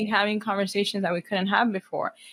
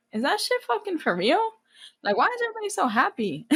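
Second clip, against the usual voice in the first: high-pitched mock disbelief; incredulous retelling; 10 semitones higher than usual. Seeing affectionate families, mockingly incredulous. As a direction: question it in a much higher, baffled pitch.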